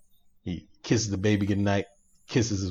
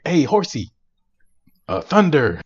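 mock yelling; 5 semitones higher, 3 dB louder than usual. Retelling Zorro calling his runaway horse, mock yell. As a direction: call out higher, half-hearted mock yell. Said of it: "he's yelling at the horse".

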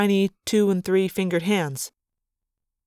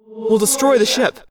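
urgent raised warning; a loud, higher warning to the troops; ordering; 4 semitones higher, 4 dB louder than usual. Acted. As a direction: keep up the command, pressing the danger. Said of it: "Alaran ordered".

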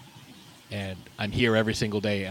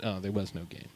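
ordinary talk, nothing marked

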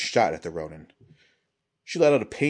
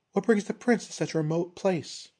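high, probing, falsely polite; pry with feigned courtesy; ronin woman voice; 11 semitones higher than usual. A narrator's reading. Risa probes the prince.

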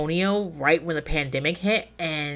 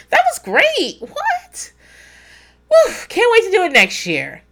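much higher, louder, laughing gush; giddy relief; 7 dB louder than usual. Retold or imitated. After the event, giddy laughing relief. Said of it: "and I'm like".

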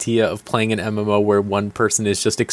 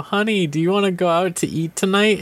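mock parent; 7 semitones higher than usual. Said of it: "And they go".

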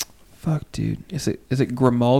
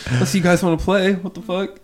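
mock innocent kid voice; 7 semitones higher than usual. Retold kids hiding toys, voiced.

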